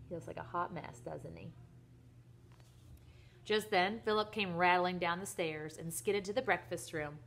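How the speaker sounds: plain reading voice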